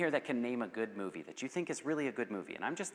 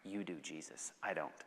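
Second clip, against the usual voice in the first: very low, soft humility; hushed, reverent unworthiness; humble refusal; 10 semitones lower, 11 dB quieter than usual. Pretend. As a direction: lay it down quietly before Jesus. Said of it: "be like".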